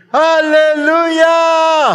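long, very high, drawn-out praise shout; shout hallelujah. Real, not acted. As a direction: cry it out slowly with full force. (malakas at mahabang sigaw ng hallelujah)

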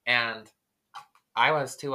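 normal talking voice

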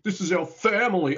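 hammed-up action-hero declaration; mock melodramatic. Co-host mocking Fast and Furious family talk, hammed-up. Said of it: "dropping the dreaded F-bomb".

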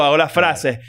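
ordinary talk

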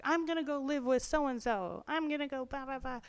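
mock bratty threat. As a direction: wheedle and threaten your mom. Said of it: "manipulate my mom and be like".

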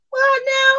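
high incredulous retort; can't believe what you heard; shocked disbelief. Retold or imitated. Retold own shocked reaction.

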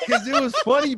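high, loud, through laughter; real laughter breaking into the words; laughing protest. Real, not acted. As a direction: defend yourself while cracking up. Laughing while defending himself.

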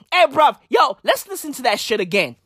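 ordinary talk, nothing marked

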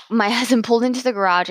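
ordinary talk

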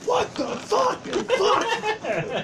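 high, furious mock rage yell; angry yell. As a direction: explode in gamer rage. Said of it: "you just hear fucking Nick go".

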